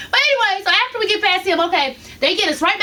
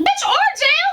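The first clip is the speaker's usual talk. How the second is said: incredulous; 10 semitones higher than usual. Retold or imitated. Incredulous at Orajel suggestion.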